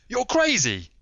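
a high, loud, furious outburst; fierce cry. A narrator's reading. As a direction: explode at him in disbelief. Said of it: "he cried fiercely".

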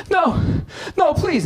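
begging victim. Retold or imitated. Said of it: "the guy's just like".

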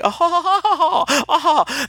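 very high, put-on "oh-oh-oh" laugh; hammed-up laugh of a grand lady; mock society laugh. Mocking friend's Downton Abbey act, affected laugh. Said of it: "I'm laughing".

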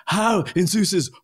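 high, exasperated movie quote; mock outraged. Quotes Cage's angry outburst from film.

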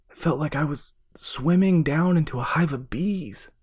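loud, high, excited shouting; calling out. A narrator's reading. Brother surfacing with the bottle, calling out excitedly. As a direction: shout across the dark water in breathless relief. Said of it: "His brother's voice called for him".

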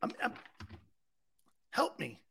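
ordinary talk

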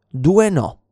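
ordinary talk

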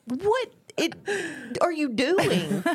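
high, drawn-out disbelief; stretched-out, high-pitched bewilderment; incredulous reaction. Retold or imitated. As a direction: imitate a baffled, stunned reaction. Retelling reaction to friend's screaming.

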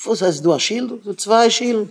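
high-pitched incredulous question, lightly acted; puzzled questioning. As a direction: play a baffled stranger, voice climbing. Acted stranger questioning desert dweller. (פארוואונדערטע פראגע, מיט א הויך קול)

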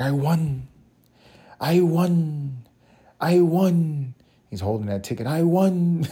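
high, loud, thrilled cries of I won; ecstatic winner. Retold or imitated. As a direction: shout it like an old man who finally won. Old man shouting his jackpot win.

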